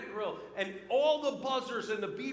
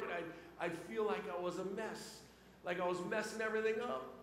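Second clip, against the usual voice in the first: ordinary talk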